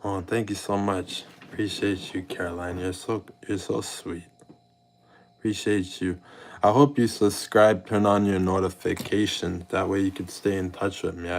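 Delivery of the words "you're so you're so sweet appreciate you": ordinary talk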